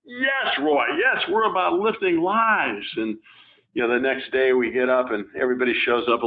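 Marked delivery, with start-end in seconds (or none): 0.0-2.9 high, jubilant cry of agreement, rising sharply at the start; rally-style cheer, voicing the whole room; cheering crowd. Speaker recalls the conference audience cheering Roy, excited high cry.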